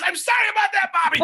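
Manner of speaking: very high, strained mock plea; desperate apology. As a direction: act out begging for mercy. Pinned brother begs forgiveness.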